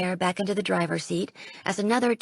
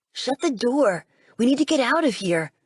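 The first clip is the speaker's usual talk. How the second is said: louder, frightened urgency; panicked order to flee; scared; 4 semitones higher, 4 dB louder than usual. Acted. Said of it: "Sam sounds scared".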